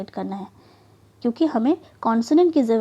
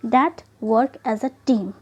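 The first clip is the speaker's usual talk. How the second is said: slow rule reading; much slower than usual. Shown as a demonstration. Teacher reading a spelling rule to the class, very slowly. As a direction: read the rule very slowly and deliberately. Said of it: "reading rule slowly".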